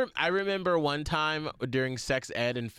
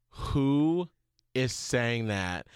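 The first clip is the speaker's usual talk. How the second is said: slow, lowered, stern question; measured adult interrogation of a child; disapproving teacher; 5 semitones lower, much slower than usual. Retold or imitated. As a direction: draw it out low, letting disapproval show. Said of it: "you could tell from her c-, tone".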